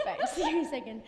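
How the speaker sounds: laughing nervously before performing. Real, not acted. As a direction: singer laughing before a requested accent. Laughing before accent demo.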